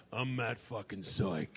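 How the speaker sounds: low, slow mock-drunk swagger; hammered tough guy. Drunk friend barging in pretending to be the absent roommate, mock swagger. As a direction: announce the name slowly and low, as if hammered.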